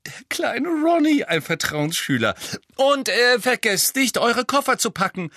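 a mother beside herself with pride, gushing high; giddy mother. A narrator's reading. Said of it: "Ach, ich bin ganz hibbelig".

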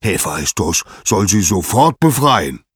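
loud, booming command; forceful acted decree; thundering. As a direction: thunder the order like an angry god. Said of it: "donnerte Zeus".

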